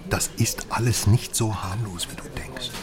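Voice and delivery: hushed urgent whisper. Acted. Whispering a warning to his contact. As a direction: lean in and breathe it as a warning.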